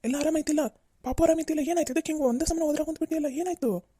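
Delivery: high-pitched worried questioning; startled concern, pitch up; alarmed. Acted. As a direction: rush in fearing something is wrong. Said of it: "ನಾವ್ ಏನೋ ಅಂತ ಹೆದ್ರುಕೊಂಡ್ಬಿಟ್ವಿ".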